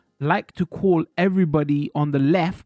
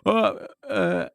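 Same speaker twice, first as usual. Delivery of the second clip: Rambo impression; 4 semitones higher than usual. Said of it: "I gotta do my Rambo accent".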